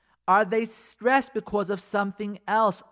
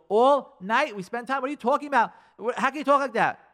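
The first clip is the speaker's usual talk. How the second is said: high, loud defensive outburst; acted-out exasperated husband; indignant protest; 6 semitones higher, 6 dB louder than usual. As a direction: argue back in disbelief. Husband argues back at wife.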